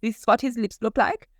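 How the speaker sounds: high, flamboyant character voice; Quincy voice. Shown as a demonstration. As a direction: slip into the character's voice. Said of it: "this is how he talked".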